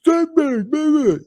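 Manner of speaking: high mock Paulie crooning baby; Paulie impression. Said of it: "And he's like".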